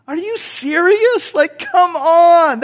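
can you believe this, drawn out high; stunned pitch-up marvel; incredulous. Real, not acted. Preacher marvels at Jesus' response.

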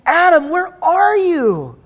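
tender call. Acted. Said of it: "God is crying out".

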